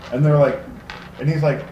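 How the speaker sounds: normal talking voice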